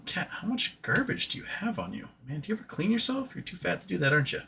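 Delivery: soft teasing to the cat; hushed playful pet talk. Real, not acted.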